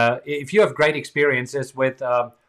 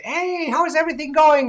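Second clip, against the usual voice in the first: cheery greeting; 3 dB louder than usual. Retold or imitated.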